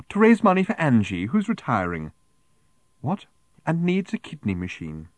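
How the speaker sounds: high, over-sweet pleading start, falling away; prim charity collector making her pitch; prim raffle-selling woman. A narrator's reading. Woman selling raffle tickets to Arthur, prim and insistent.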